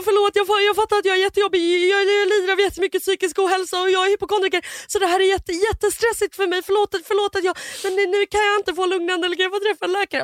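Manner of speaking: high, panicky apologetic babble; acted guilty panic, high voice; panicked, guilty. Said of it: "jag har panik blandat med skuld".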